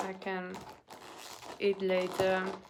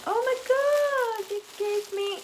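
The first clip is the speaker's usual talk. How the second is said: high, loud gushing exclamation; mock overjoyed; 11 semitones higher, 5 dB louder than usual. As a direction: squeal it as if given a treasure. Mock gratitude for being given cutlery.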